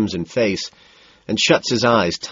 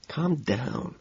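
soft calming; 5 semitones higher, 10 dB quieter than usual. A narrator's reading. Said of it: "I tell him".

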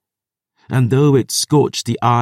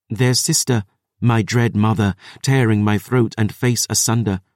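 ordinary delivery

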